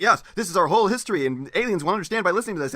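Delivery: mock the record as if it spoke with great self-importance; mocking pompous voice. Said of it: "It's like, it's like".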